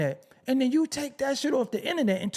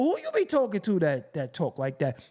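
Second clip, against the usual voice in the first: ordinary talk, nothing marked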